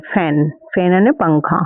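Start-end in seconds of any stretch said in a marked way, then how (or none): 0.1-0.5 फैन with the flat ae, not फन; correct vowel demo. Shown as a demonstration. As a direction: say फैन, lower and clear, with the ae sound. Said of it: "फन थोड़ी पढ़ेंगे".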